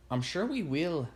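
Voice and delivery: put-on thick Scottish accent. A narrator's reading. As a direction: answer as a Scottish woman. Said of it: "in a thick Scottish accent".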